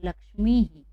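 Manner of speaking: "Lakshmi ही" closing on an echoed ही; visarga demonstration. Shown as a demonstration. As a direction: voice the visarga in "Lakshmi ही" as ह plus long ई. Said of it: "हिकार का ही उच्चारण".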